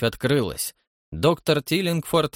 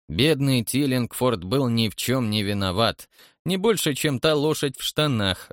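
usual speaking voice

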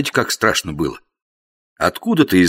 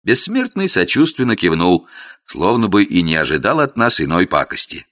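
ordinary delivery, nothing marked

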